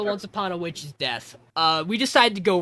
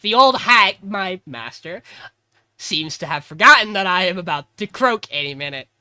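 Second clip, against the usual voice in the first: mock indignant Meg; 8 dB louder than usual. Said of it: "where she's like".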